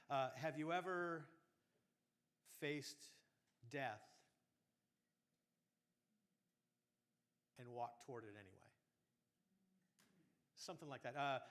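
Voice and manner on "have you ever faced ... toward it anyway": long pauses between faced and death, fading; dramatic pauses. Shown as a demonstration. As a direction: hold back each word for weight. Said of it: "if you said something along the lines of".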